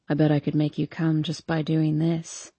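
low, level male character voice. A narrator's reading.

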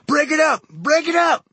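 high, loud shouted order; distant yell. A narrator's reading. Someone breaking up a street fight, high-pitched yell. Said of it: "Someone up the street in the dark yelled".

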